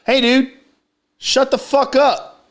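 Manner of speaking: high-pitched, drawn-out mock telling-off; slow, high retold demand; retold confrontation.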